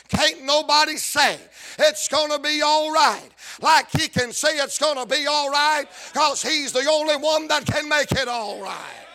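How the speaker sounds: rhythmic, rising gospel preaching; full-force preaching. Acted. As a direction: preach it to the congregation, building to a shout.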